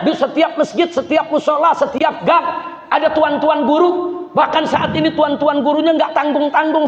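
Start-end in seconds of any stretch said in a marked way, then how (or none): 4.3-6.9 rally them to the ulama; fiery preaching. Acted. Preacher urging the congregation to follow the ulama.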